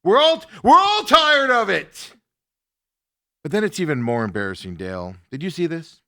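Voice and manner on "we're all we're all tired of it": loud, higher mock dressing-down of the coach; an imagined boss storming into the locker room, loud and angry; imagined telling-off. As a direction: bark it like a fed-up boss. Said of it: "bust into that locker room and be like".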